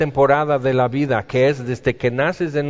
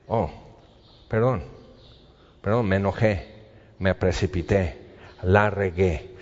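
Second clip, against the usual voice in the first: softer, lower, sheepish apology; soft contrite apology; 7 semitones lower, 6 dB quieter than usual. Retold or imitated. Man apologizing to his brother after a quarrel, softly contrite. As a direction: back down gently, owning the mistake.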